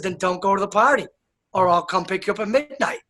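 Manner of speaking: normal talking voice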